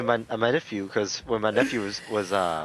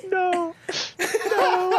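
put-on much higher squeaky little kid pleading; high crying toddler voice. Imitating his nephew begging him not to call the cops, high squeak.

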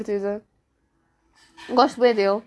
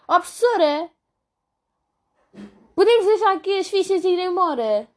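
higher, louder mock call to the teacher; loud classmate impression; 7 semitones higher than usual. Imitating the loud girl asking the teacher at the end of class, mock yell. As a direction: belt it out like the girl asking to leave. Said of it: "E ela grita".

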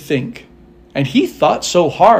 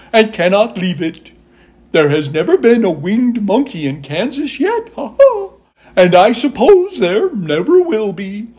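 high, loud monkey voice with whoops; hooting Monkey King; 6 semitones higher, 3 dB louder than usual. Acted. Monkey King declining Dorothy's wish, high whooping monkey. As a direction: swoop through the words, hooting between phrases.

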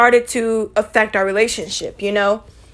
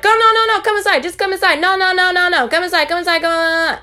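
high, fast nagging father repeating no; insistent dad; 8 semitones higher than usual. Retold or imitated. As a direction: imitate the dad begging them to come in, pitched way up. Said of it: "And he still was like".

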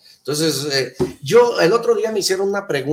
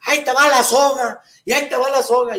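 much higher, louder shout; calling out; 10 semitones higher, 7 dB louder than usual. Retold or imitated. Ranchers shout to drowning man, much higher and louder. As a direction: shout across the river, throwing a rope.

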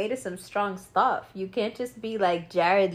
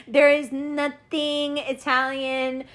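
laughing; 5 semitones higher, much slower than usual. Real, not acted. Laughing at the lost accent.

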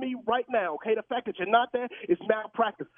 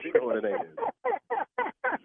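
higher, slow drawn-out words; drawn-out mock; 8 semitones higher, much slower than usual. Real, not acted. Husband ruefully repeating line about his wife, slow and teasing.

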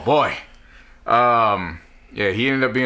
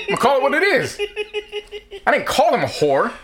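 emphatic rant; 9 semitones higher, faster than usual. Real, not acted.

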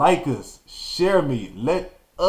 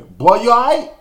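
put-on a much higher, louder "Boy, you all right?"; worried uncle call; 10 semitones higher, 9 dB louder than usual. Uncle calling to the fallen boy, a high loud worried call. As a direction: call out to the kid who just fell. Uncle calls out after fall.

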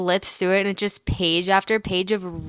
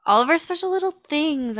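high cutesy voice; 9 semitones higher, faster than usual. Shown as a demonstration. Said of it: "I'm always saying that".

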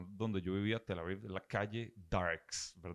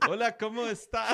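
put-on much higher, louder Israeli accent in Spanish; mock Israeli accent; 12 semitones higher, 5 dB louder than usual. Retelling the Israeli roommate's greeting, exaggerated Israeli accent. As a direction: parody the Israeli accent with a big lift. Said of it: "así hablan los israelíes con acento en español".

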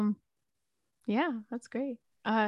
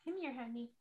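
much softer, tender coaxing to a dog; coaxing pet; 3 semitones higher, 20 dB quieter than usual. Real, not acted. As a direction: coax the puppy over gently.

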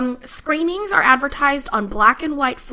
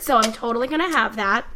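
usual speaking voice

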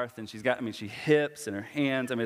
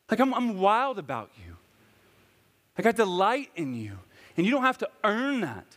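much higher, louder, impassioned sermon climax; preaching at full force; 11 semitones higher, 3 dB louder than usual. Acted. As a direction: pour your heart out to the congregation.

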